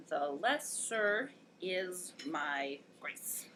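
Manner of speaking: "the lesser is my grace" soft and very slow, each syllable apart; slow metrical scansion. Shown as a demonstration. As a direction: measure out "the lesser is my grace" beat by beat. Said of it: "Now, let's scan this".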